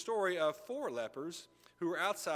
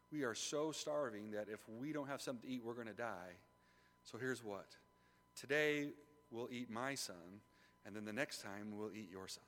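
low, quiet voice of a starving woman; subdued, lowered acted plea; hushed grim proposal; 6 semitones lower, 8 dB quieter than usual. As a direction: propose a dreadful pact softly.

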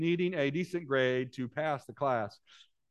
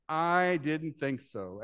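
drawn out, very slow and deliberate; slowly; much slower than usual. Acted. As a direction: let each word land, slow and cool. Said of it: "said slowly".

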